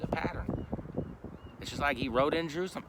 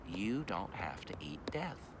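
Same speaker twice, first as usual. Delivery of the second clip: softer and slow, pressing it home gently; soft repeat; 5 semitones lower, 7 dB quieter than usual. Shown as a demonstration. Preacher softly repeats his point to the listeners, quiet and slow. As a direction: drop the voice and slow down on You don't have to eat death.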